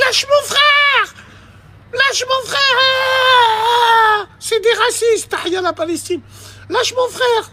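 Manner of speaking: high, drawn-out mock wail; imitated sister yelling. Sister pleading with police for her little brother, imitated high wail. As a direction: screech in falsetto like a protesting sister.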